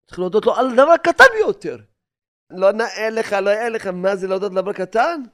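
high, loud protest, easing off; mock a proud man's objection; mock indignation. Mocking the proud person's objection. (מחאה מזויפת של גאוותן)

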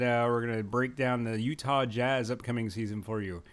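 ordinary delivery

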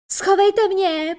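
high, loud, drawn-out plea; frightened begging. A narrator's reading. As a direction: beg desperately for cover. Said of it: "prosil Hák". (zoufalá hlasitá prosba)

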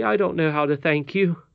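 a touch of overflowing gratitude; grateful cry. A narrator's reading. Curdie thanks the old lady for mending the bird, mildly excited. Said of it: "cried Curdie".

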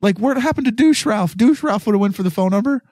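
mock frat-bro disbelief; excited bro. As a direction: hype up Ralph like an incredulous bro. Imagined quote to Ralph.

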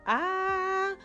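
high singing vowel ah; high sung ah. Pretend. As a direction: belt a high ah. High far sung note.

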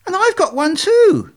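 very high, loud duckling glee; little bird's high-pitched excitement; excited naive duckling. Acted. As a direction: pipe up, thrilled with yourself. Duckling excited at own reflection.

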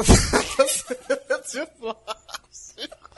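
slow laughing struggle. Real, not acted. Forcing line through laughter.

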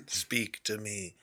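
hushed plea. Acted. Merlin rubbing hat, hushed.

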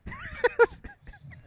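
sudden high laugh; burst of laughter. Real, not acted. Reacts to friend's jab.

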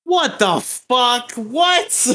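high, stunned "what the fuck"; shocked disbelief. Real, not acted. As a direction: gasp it out in disbelief. Shocked at S tier ranking.

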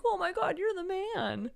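put-on high gushing girls' voice; fangirl gush. Friend imitating Viola's friends fawning over Sebastian in high gushing voices. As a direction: swoon over him like a fan. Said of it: "they're like".

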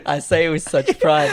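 loud laughing talk; cracking up mid-sentence. Real, not acted. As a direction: say it through a real laugh. Cracks up at himself.

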